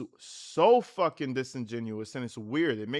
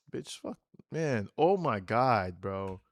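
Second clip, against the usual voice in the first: usual speaking voice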